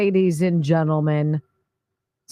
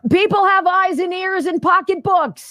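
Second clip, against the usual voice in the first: mock pundit voice; 11 semitones higher, 4 dB louder than usual. Mock Enton answer, high pitch.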